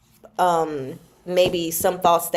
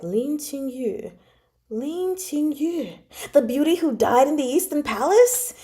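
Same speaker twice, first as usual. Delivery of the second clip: eerie echo; 6 semitones higher than usual. Retold or imitated. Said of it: "And it was like".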